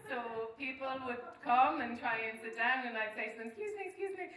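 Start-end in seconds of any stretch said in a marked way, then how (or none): 3.5-4.3 timid excuse me. Retold or imitated. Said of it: "this type of voice that, like, is actually hard to hear".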